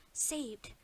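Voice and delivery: plain reading voice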